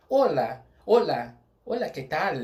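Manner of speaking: olá, que tal said in Spanish, loud and bright; Spanish greeting demo. Shown as a demonstration. Teacher demonstrates the Spanish greeting, loud. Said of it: "você fala".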